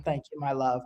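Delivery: normal talking voice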